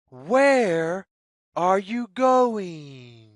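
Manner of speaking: plain reading voice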